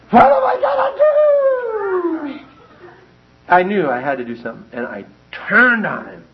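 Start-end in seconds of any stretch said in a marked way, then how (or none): 0.1-2.4 very high, loud, drawn-out mock wail; panicked wail. Running from the dog with no escape, panicked wail. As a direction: wail it out slowly in hammed-up panic.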